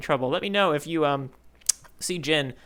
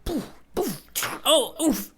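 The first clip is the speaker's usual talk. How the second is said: mock punch noises; 9 semitones higher, slower than usual. Panels punching each other.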